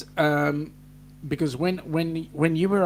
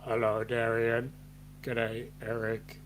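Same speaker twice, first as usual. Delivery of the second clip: lightly imitated greeting, pitched low; a low, subdued impression of someone saying hello; Paul; 6 semitones lower, 7 dB quieter than usual. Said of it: "in the words of Paul".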